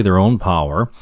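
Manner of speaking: usual speaking voice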